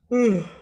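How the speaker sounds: long weary exhale, high then falling; big tired sigh. Shown as a demonstration. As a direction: let out a loud exhausted sigh. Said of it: "I could yawn a little bit".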